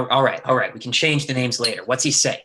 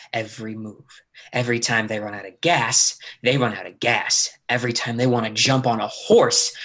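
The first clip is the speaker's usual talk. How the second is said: menacing low voice; 3 semitones lower than usual. Acted. Lee's menacing deal, held low.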